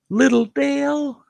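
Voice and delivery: high-pitched mock disbelief; incredulous. Storyteller replaying his shock at a friend's new boyfriend, incredulous. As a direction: squeak it out, astonished.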